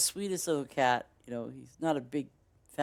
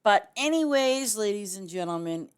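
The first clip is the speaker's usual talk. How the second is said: announcer-style transition; 7 semitones higher, 7 dB louder than usual. Real, not acted. Loud sign-off transition.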